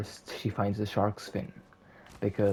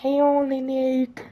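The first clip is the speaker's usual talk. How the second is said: witch voice; 8 dB louder than usual. Acted. Witch lists her ingredients to Cinderella in a high cackling screech. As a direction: list the ingredients like a gleeful hag. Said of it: "the witch says".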